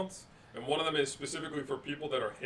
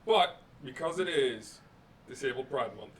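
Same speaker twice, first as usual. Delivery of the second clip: ordinary delivery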